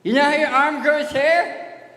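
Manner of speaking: high, loud, hammed-up creepy storyteller; mock creepy voice. Comic telling kids a gross story in a loud, high mock voice. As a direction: ham it up like a spooky tale for kids.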